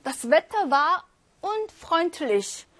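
Das Wetter war unfreundlich read very slowly; slow German read-aloud. Shown as a demonstration. Teacher reading the exam sentence slowly to students. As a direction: dictation pace, each word separate. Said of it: "복모음 다시 한번 또 보세요".

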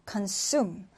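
consume with the stress on SUME; stress on second syllable. Shown as a demonstration. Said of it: "뒤듬절의 강세를 두고요".

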